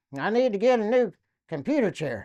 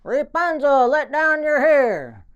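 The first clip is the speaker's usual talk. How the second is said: loud, higher, slow call; yelling up; 6 semitones higher, 6 dB louder, slower than usual. Retold or imitated. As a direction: call it up to the tower like a fairy tale. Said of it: "I heard him yelling".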